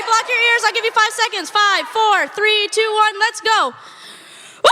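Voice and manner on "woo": loud off yell. Pretend. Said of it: "do you think we could have a quick loud off".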